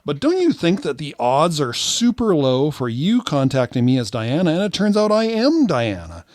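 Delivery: high, swooping mock-earnest voice; feigned innocence. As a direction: reason with wide-eyed fake amazement. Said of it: "I say".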